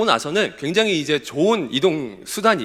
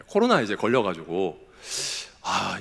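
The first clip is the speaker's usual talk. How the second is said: normal talking voice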